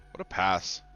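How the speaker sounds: normal talking voice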